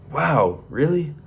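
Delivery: wow rising high in disbelief; amazed. Acted. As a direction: pitch it up, wide-eyed and amazed. Young farmer amazed by rooster.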